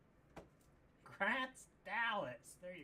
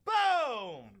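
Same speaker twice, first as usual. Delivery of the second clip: loud boom; 7 dB louder than usual. Real, not acted. Hyped card reveal.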